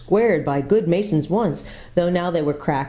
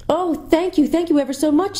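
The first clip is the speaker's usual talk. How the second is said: put-on children's voices; 12 semitones higher than usual. A narrator's reading. Children thank the lady.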